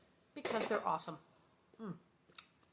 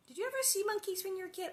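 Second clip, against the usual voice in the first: excited; 11 semitones higher, much faster than usual. Real, not acted. Excited question to viewers.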